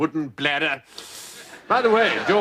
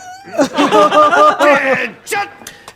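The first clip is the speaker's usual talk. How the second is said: booming guffaw; 8 semitones higher, 8 dB louder than usual. Acted. General laughs before checking patient.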